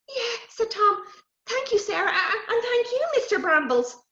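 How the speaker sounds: a little magic creature, kind but wise; small fairy voice; hedge sprite. Acted.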